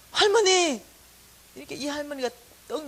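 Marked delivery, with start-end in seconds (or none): 0.1-0.8 loud, high call at a door. Acted. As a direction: shout for Grandma from outside. Calls at neighbour's door.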